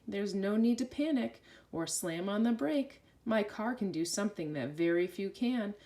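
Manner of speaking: ordinary delivery, nothing marked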